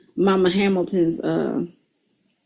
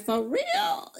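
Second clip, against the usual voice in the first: incredulous; 8 dB quieter than usual. Retold or imitated. Stunned by mother's lesson.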